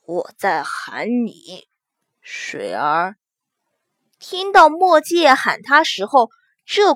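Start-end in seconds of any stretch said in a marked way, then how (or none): 0.0-3.1 low, slow words through clenched teeth; through gritted teeth. Acted. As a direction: grit your teeth and drawl it low. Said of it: "咬着后槽牙".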